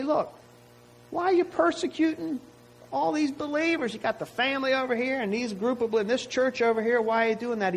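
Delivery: the words Jesus did not say, lifted high; hypothetical Jesus. Retold or imitated. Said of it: "He didn't say".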